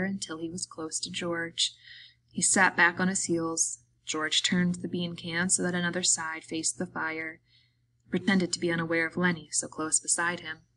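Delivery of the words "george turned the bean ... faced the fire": ordinary delivery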